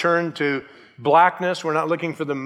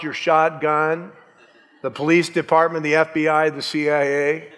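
normal talking voice

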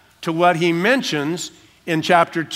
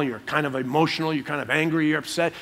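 usual speaking voice

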